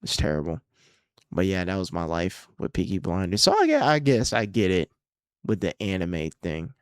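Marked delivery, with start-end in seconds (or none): none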